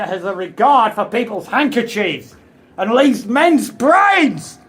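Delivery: ranting Dickens. Acted. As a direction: thunder it from the podium. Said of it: "Chas was pretty worked up".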